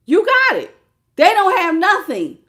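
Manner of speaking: loud and high, fired up; loud, delighted reveal; excited. Real, not acted. Excited tarot reading climax.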